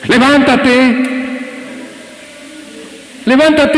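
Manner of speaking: gritado, mucho más agudo y fuerte; anímalo como multitud en la arena; gritos del público. Retold or imitated. (cinco mil hombres le gritan al caído, fuerte)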